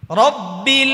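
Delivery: রব্বিল loud with a rising end; recitation demo. Shown as a demonstration. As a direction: sound রব্বিল out loud, doubled ব held. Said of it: "এই জায়গায় একটু ঝামেলা". (জোরে রব্বিল উচ্চারণ)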